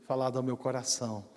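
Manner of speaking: low, hushed, tender voice of the Spirit; sweet voice. Retold or imitated. Preacher recalls the Spirit speaking to his heart, sweet and hushed. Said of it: "doce voz do Espírito".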